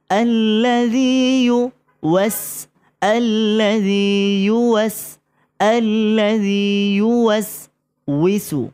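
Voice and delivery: আল্লাযী ইউওয়াস chanted slowly, high; slow drilled recitation. Shown as a demonstration. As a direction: build up ইউওয়াসউইসু piece by piece. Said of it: "এটা বলতে অনেকের কষ্ট". (ধীরে ধাপে ধাপে উচ্চারণ)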